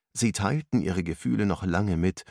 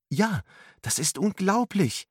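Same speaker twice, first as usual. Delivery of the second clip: put-on much higher, amazed girl's cry; excited exclamation; 12 semitones higher than usual. A narrator's reading. Angela marvels at the lesson with her friends, excited. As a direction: burst out in wonder. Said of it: "rief Angela aus".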